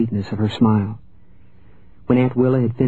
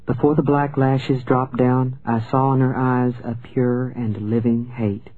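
plain reading voice

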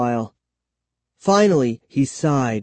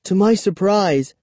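ordinary delivery, nothing marked

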